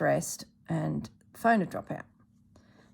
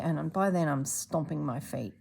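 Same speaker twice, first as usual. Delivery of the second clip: usual speaking voice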